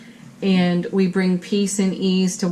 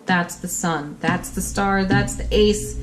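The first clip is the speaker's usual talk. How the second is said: ordinary talk, nothing marked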